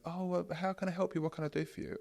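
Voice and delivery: high, soft eager-to-please voice; sugary people-pleaser. Retold or imitated. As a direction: simper like a pushover agent. Overly nice agent voice.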